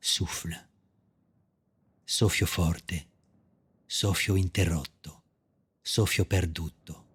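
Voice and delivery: hushed, slow bilingual poetry reading; poem recitation. Acted. Poet evoking the wind in exile, slow sparse recitation. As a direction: space out each short phrase like a breath.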